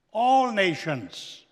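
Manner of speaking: ordinary talk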